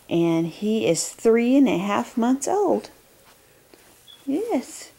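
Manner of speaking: ordinary delivery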